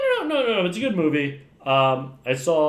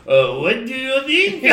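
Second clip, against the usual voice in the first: hammed-up French accent, high and loud; mock a French king's English, laughing; 9 semitones higher, 5 dB louder than usual. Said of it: "he's just like".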